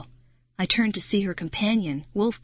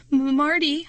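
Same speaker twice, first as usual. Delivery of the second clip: higher, louder stammered name; stammering; 7 semitones higher, 4 dB louder than usual. Acted. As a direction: stammer his name in fear. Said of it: "I stammered".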